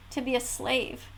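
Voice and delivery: ordinary talk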